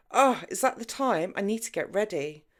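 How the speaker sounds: startled, then rattling off an excuse; flustered exclamation. A narrator's reading. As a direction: caught out and rushing to cover. Jenny caught out, flustered.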